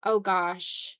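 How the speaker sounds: ordinary delivery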